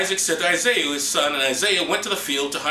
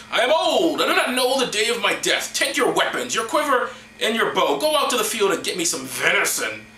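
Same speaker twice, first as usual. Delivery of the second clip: high, swooping declamation; theatrical old patriarch; 6 semitones higher, 3 dB louder than usual. Acted. Old Isaac sending Isaiah out to hunt, hammed-up pronouncement. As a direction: declaim it grandly to your son.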